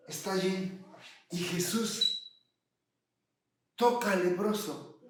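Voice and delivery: normal talking voice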